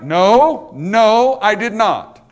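loud, doubled NO, each one punched; emphatic denial. Acted. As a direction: deny it flatly with a hard-hit "No". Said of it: "That's the emphatic use of the word".

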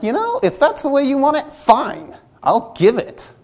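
imitating a churchgoer losing it with someone; huffy. Acted huffy church quarrel.

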